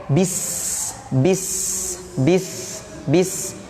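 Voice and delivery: "Bis" with the s hissed, breath flowing through. Shown as a demonstration. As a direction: let air stream out on the s of "Bis". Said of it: "huruf sinnya ada nafas mengalir". ("Bis" dengan desis s yang mengalir)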